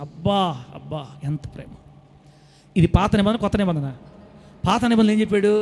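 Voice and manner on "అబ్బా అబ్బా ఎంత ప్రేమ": tender awe. Real, not acted. Marvels at God's love.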